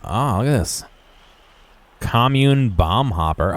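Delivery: normal talking voice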